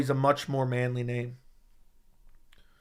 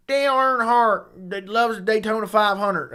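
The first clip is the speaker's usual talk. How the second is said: slow, higher-pitched country drawl; country accent; 5 semitones higher, slower than usual. Shown as a demonstration. Streamer answering a viewer's request, exaggerated country accent. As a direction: put on your best country accent. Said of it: "That's the best I got for country accent".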